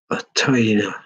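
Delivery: ordinary delivery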